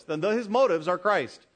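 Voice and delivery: usual speaking voice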